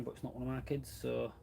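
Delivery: ordinary delivery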